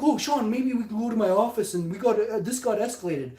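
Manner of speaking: high, loud mimicry of a pleader; mocking imitation of an authority figure pleading; mock placating official.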